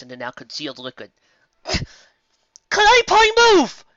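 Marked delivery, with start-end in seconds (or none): 1.6-3.8 high, loud panicked call; fighting down panic as she calls out. A narrator's reading. Octavia calling to the stuck ponies, high and panicked. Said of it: "trying to keep the panic from her voice".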